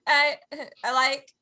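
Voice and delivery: ordinary talk, nothing marked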